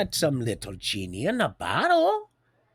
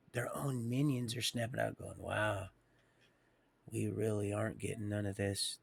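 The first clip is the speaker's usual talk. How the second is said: hushed; 6 semitones lower, 13 dB quieter than usual. Real, not acted. Long hushed stretch, much softer.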